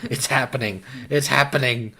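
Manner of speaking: growing mock alarm. Teasing friend's lightheadedness.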